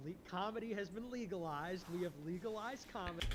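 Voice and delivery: loud, proud announcement of victory; triumphant proclamation. Real, not acted. Gleeful host announcing comedy is legal. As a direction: declare it like a headline win.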